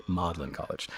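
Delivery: British accent, Magdalen as MAUD-lin; British pronunciation. Shown as a demonstration. Showing the Brit way to say Magdalen, British accent. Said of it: "You gotta say it like you're a Brit".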